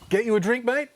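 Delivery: high, loud offer of a drink; perky Aussie party guy; cheery little bloke. Retold or imitated. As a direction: pop up bright as an elf.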